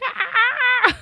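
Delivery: high-pitched imitation scream; mock scream. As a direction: scream like a mom chasing her car. Mom screaming after the car.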